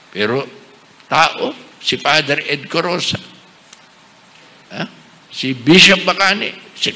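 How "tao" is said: normal talking voice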